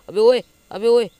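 alarmed shout. Real, not acted. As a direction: holler at the attacker in alarm. Calling out under attack. (घबराकर ज़ोर से पुकारना)

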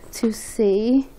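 breathless whisper. Real, not acted. Too nervous to look, a whisper. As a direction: whisper the last words under the nerves.

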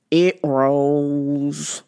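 "It rolls" said low and flat, like a shrugging guy; deadpan it like you don't care; mock flat excuse. Imitating the truck owner's lazy low "It rolls".

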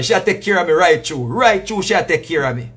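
usual speaking voice

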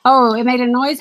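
usual speaking voice

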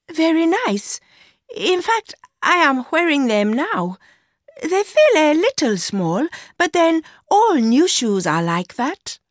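put-on high-pitched young woman's voice; Mercy, young mistress. A narrator's reading. Mistress telling her lover about the new shoes, high young woman's voice.

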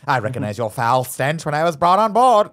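hammed-up British accent, rising high and loud. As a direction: do a theatrical mock-British princess, building in pitch. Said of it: "she's British all of a sudden".

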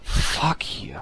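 hushed curse; whispering. Real, not acted. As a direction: whisper it under breath.

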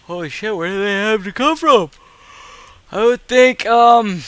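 speaking through a yawn. Real, not acted. Tired gamer wondering where enemies come from, yawning speech. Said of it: "I just yawned again".

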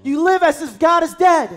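loud, high shouted accusation; forceful rebuke. Acted. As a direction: hammer the point home. Climactic rebuke in sermon.

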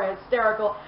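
ordinary talk

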